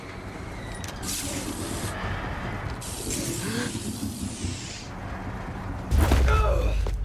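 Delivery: rising startled gasp, no voice; sharp gasp. Acted. Hero caught off guard while sneaking, startled gasp. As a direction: catch the breath in fright.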